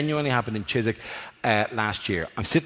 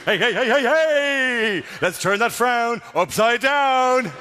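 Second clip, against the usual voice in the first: clown voice; 8 semitones higher, much slower than usual. Acted. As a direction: burst in like a manically cheery clown. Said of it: "get Coco".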